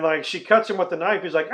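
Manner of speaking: usual speaking voice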